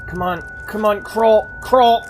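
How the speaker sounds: high urgent pleading. Real, not acted. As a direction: urge yourself on, frantic. Crawling to safety in game.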